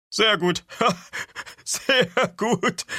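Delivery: high, loud chortle through the words; chuckling. Acted. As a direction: laugh heartily between the words, highly amused. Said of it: "gluckste Katzenkissen".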